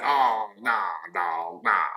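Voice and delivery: low, drawn-out mimicked refusal; mock reluctance. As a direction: imitate a client balking at the idea. Mimics clients refusing to raise prices.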